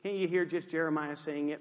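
ordinary talk, nothing marked

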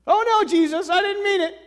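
high, loud, panicked squeal of regret. Pretend. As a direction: squeak out a horrified "oh no". Panicked mock reply.

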